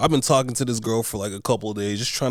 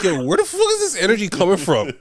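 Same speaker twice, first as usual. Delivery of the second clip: higher, louder shocked disbelief; incredulous outburst; 8 semitones higher, 5 dB louder, faster than usual. Retold or imitated. Reacting to the coworker's jab, loud and incredulous. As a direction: exclaim it in disbelief, loud and high. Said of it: "And I'm like".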